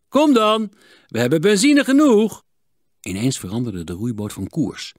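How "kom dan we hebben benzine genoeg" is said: hoog en luid jennen; uitlachend. A narrator's reading. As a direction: daag je achtervolgers roepend uit. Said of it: "lachte hun belagers uit". (spottend geroep, hoog en luid)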